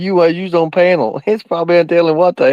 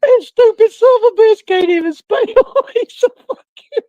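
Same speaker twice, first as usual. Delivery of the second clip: laughing. Real, not acted. Laughing at misspelled comment.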